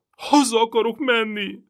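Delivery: high, loud wail through sobs; bawling. A narrator's reading. Frightened boy bawling that he wants home. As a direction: howl it while crying. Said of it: "Gergő is bőgött".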